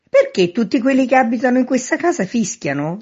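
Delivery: high, loud challenge; shouted question. A narrator's reading. Man in street questions man at window, shouting. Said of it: "domandò gridando il signor Veneranda".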